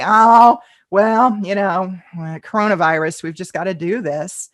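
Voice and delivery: big weary "oh", then sinking away; mock unenthusiasm. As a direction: act out a reluctant, resigned announcement. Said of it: "You've gotta build some enthusiasm".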